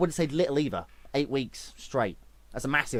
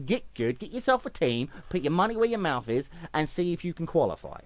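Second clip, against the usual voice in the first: ordinary delivery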